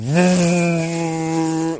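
long, steady droning whirr; machine revving noise. Retold or imitated. Retelling how something starts up, droning machine noise. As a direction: imitate an engine humming into life.

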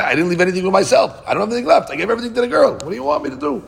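high, pleading voice of the desperate father; exasperated father pleading. Retold or imitated. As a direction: act out the father begging to be let off. Father pleads, has nothing left.

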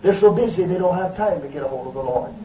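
usual speaking voice

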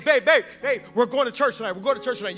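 loud, high excited phone call; urgent announcement. Retold or imitated. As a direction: burst out with news to a girlfriend. Acted phone call to girlfriend.

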